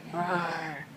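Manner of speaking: low, soft growled roar; bear roar. Pretend. Parent showing a child the bear, low soft roar. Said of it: "That's bear".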